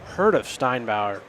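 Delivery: ordinary delivery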